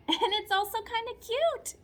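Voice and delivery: laughing. Real, not acted. Teacher laughing to class over a cute ghost crab. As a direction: giggle at how cute it is.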